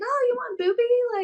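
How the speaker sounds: put-on very high, singsong offer to a baby; cooing baby talk. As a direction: coo it way up high to a newborn. Mother offering breast to newborn, retold.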